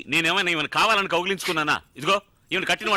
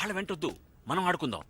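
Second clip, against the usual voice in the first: coaxing softly; 9 dB quieter than usual. Acted.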